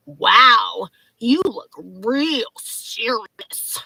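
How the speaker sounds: slow, drawn-out breathy mimicry; mocking. ID checker teasing woman about her serious face, mocking. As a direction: drag it out in mock gravity. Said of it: "Like, mocking my face".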